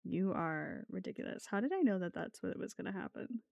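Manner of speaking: ordinary talk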